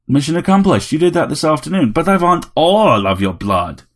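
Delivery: a tiny robot playing a grand vampire; robot Dracula. A narrator's reading. Vampire robot telling Harry it wants all his blood.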